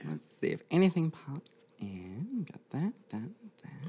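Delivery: slow focused whisper. Real, not acted. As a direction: whisper slowly, concentrating. Medium concentrating during reading.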